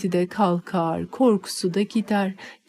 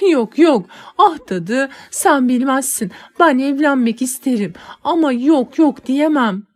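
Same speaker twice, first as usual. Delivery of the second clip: tearful pleading; 5 semitones higher, 5 dB louder than usual. Acted.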